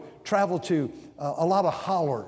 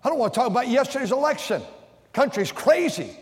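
mock refusal; 7 semitones higher, 3 dB louder than usual. Imitating friend refusing politics.

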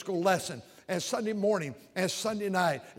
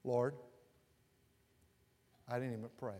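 low, soft muttered rebuke to himself; quiet retold self-talk; 6 semitones lower, 9 dB quieter than usual. Preacher retelling scolding himself while searching, low and soft. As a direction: mutter it to yourself, almost ashamed. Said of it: "I said".

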